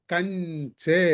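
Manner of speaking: కంచె drawn out very slowly with the nasal ready for చ; teacher modelling కంచె; slow model word. Shown as a demonstration. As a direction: say కంచె slowly, mouth set for చ. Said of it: "చా ఎలా పలికేమో అలాగే".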